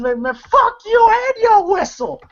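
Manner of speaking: high-pitched squawking insult; mock angry retort. Old lady answering the whistle threat, high mock anger. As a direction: act out an outraged comeback, pitch way up.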